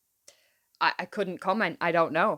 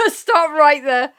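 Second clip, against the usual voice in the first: laughing through the words; giggling, slowed right down; 3 semitones higher, 6 dB louder, slower than usual. Real, not acted.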